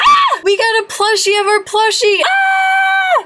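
very high, loud shrieks of joy; thrilled scream. Real, not acted. Vlogger shrieking at the plushie prize. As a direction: shriek like the best prize ever. Final rare code prize.

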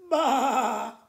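loud bleating baa; the sheep's big loud baa. Acted. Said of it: "The sheep said".

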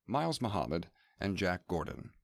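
normal talking voice

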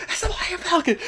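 high, laughing fanboy squeal; imitated ecstatic fan. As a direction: ape a geek losing it with joy. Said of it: "and he goes".

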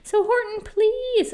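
high-pitched little mayor, pleading; pleading tiny mayor. A narrator's reading. Mayor begs Horton after the crash, pleading. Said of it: "pleaded that voice of the mayor's".

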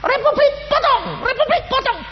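high yelled war cry, again and again; retold rebel shout. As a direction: holler it like attackers in the dark.

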